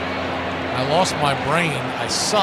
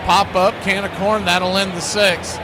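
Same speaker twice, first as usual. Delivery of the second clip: lively, louder ballgame call; play-by-play call; 8 semitones higher, 4 dB louder than usual. Acted. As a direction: call the action for listeners at home.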